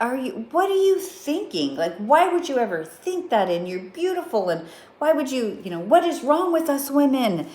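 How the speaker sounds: high, exasperated mock lecture; mock scolding friend. To her daughter, a high exasperated scolding. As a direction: scold her like a fed-up mom. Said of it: "I'd be like".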